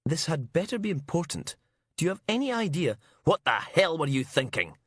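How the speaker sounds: acted woman's angry phone voice; irritated outburst. A narrator's reading. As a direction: tear into him down the line. Furious caller berates the inspector.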